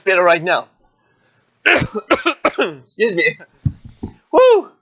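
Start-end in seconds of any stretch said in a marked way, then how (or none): 1.3-3.4 coughing up water, slow; coughing fit. Real, not acted. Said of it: "I had a little bit of a cough attack". 4.3-4.7 high, loud relieved woo; relieved whoop. Real, not acted. Recovering from choking on water.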